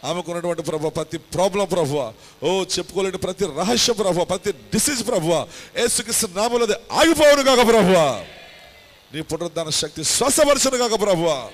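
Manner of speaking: from steady to loud, high pleading; building healing prayer. Acted. Pastor praying for the sick, rising to a loud cry. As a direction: swell into a fervent plea for healing.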